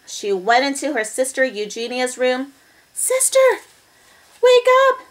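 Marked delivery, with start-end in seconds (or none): 2.9-5.0 high, loud wake-up call; little sister's voice. A narrator's reading. Baby waking her sister, high urgent call.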